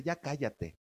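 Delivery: soft, hushed inner conscience; conscience hushing. Retold or imitated. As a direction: hush yourself like a scolding conscience. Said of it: "tu conciencia te dice". (susurro suave de la conciencia)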